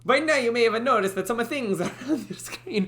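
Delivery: high Italian-accented voice, cracking up; hammed-up Italian accent. Shown as a demonstration. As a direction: lay the Italian on thick. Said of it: "Why am I going Italian?".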